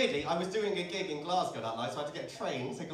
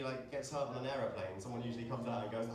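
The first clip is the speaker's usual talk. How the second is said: ordinary talk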